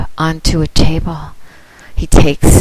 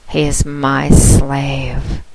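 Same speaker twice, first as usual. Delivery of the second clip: ordinary talk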